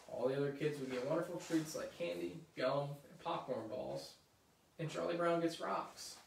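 usual speaking voice

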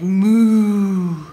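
a loud mooing cow; the cow joining in. Acted. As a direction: moo like a cow. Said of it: "when the cow said".

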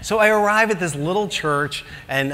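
ordinary delivery

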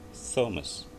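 "Thomas" said slowly with a soft airy th; slow "Thomas" with an unvoiced th. Shown as a demonstration. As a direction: breathe the th in "Thomas". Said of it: "jadi ada s gitu ya, soft nih".

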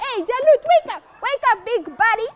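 high-pitched call to a sleeper; rousing someone. Acted. Soldiers calling fallen Jalut to get up, urgent.

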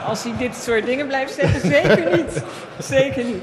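firm no with laughter in it; amused refusal. Real, not acted. Panellist rejecting working with a rival politician, amused refusal.

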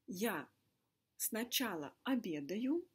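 ordinary delivery

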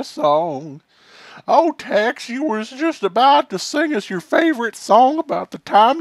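high, hammy mourning voice; mock eulogy. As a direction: wail it like a sad ballad. Eulogy for cowboy Tex, high voice.

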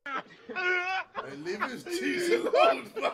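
laughing. Real, not acted. Laughing mock scold.

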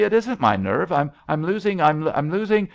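high, loud, stammering protest; screamed panic. A narrator's reading. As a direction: cry out frantically, tripping over the words. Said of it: "screamed Billy".

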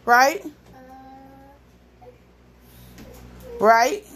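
loud, rising and pointed; loud pointed question. Real, not acted. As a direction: demand an answer loudly. Scolding the toddler.

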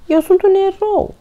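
very high, loud insistence; indignant shout. A narrator's reading. Toni insists he is a hero, indignant shout. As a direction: proclaim it hotly. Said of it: "strigă Toni".

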